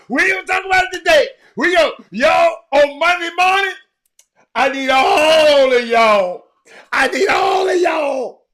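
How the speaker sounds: high, loud, slow holler; rallying shout. Pretend. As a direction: belt it out to rally everyone. Hollers to rally listeners for Monday.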